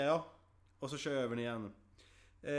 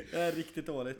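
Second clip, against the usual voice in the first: ordinary talk, nothing marked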